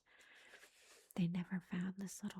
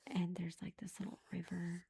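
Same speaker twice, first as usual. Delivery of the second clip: ordinary delivery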